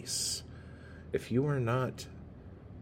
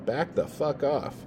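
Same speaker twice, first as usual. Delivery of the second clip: voicing the creaky chair; 6 semitones higher, 7 dB louder, slower than usual. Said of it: "it's like".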